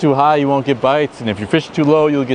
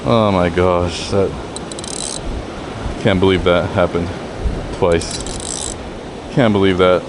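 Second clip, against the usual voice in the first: dejected; 8 semitones lower, 3 dB quieter than usual. Real, not acted. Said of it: "Oh, I'm sick".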